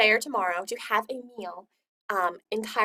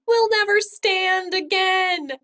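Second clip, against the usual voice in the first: put-on high, slow despairing kid voice; 9 semitones higher, 6 dB louder, slower than usual. Boy floating near the ceiling, lamenting: high slow wail. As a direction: pitch it up and drag it out in despair. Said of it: "Raphael cried".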